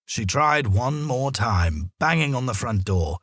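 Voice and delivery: ordinary delivery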